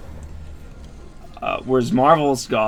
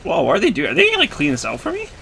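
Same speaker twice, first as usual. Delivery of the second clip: surprised; 8 semitones higher, faster than usual. Real, not acted. Units attack on their own.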